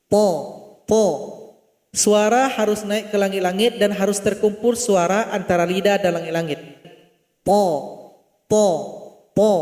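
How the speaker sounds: "To" thick and full, held up against the palate; heavy to. Shown as a demonstration. Teacher demonstrating the heavy to letter. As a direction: say "to" heavy with a firm t. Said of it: "Ujung lidah tempelkan ke dinding gigi seri".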